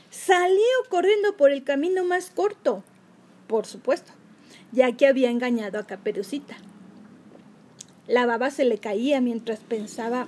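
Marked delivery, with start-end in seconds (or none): none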